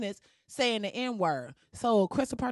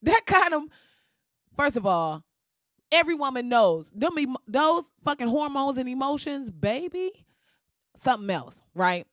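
normal talking voice

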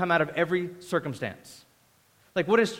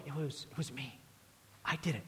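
very soft, hesitant, lower voice; quiet, fearful confession; 4 semitones lower, 15 dB quieter, faster than usual. Acted. Healed woman confesses to Jesus, quiet and fearful. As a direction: own up timidly, barely audible. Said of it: "quietly, with fear and trembling".

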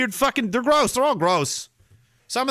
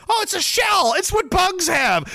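mock condescension; 11 semitones higher than usual. Streamer mocking his own explanation, sarcastic high voice. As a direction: talk down like it's obvious.

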